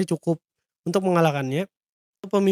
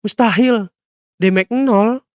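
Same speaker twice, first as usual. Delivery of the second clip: louder, higher disbelieving exclamation; stunned attacker's outburst; shocked; 3 semitones higher, 5 dB louder, slower than usual. Acted. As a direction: blurt it out in disbelief. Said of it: "Si penyerang pun shock".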